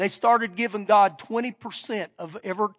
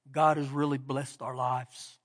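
tearful; 9 semitones lower, 9 dB quieter than usual. Retold or imitated. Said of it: "He has these tears coming down".

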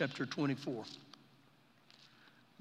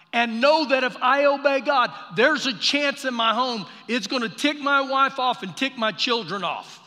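preaching at full force; 7 semitones higher, 9 dB louder than usual. Acted. Preacher challenging the congregation about obeying God, fervent preaching. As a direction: press the question on the church.

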